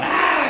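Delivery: high creature shriek; scream. Retold or imitated. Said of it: "I'm screaming and running around".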